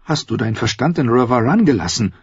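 scornful Cersei. Acted. Sister doubting her brother's sanity, high scornful voice. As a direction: scold him with high-pitched disbelief.

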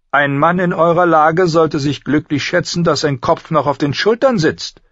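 high-pitched queen's pronouncement; imperious queen, threatening; haughty queen. A narrator's reading. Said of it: "verkündete die Königin".

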